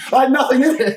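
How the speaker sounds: high and loud, laughing through it; cracking up at his own story. Real, not acted. Guest retelling the fight, laughing out loud.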